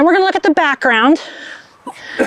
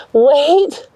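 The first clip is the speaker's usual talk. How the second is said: normal talking voice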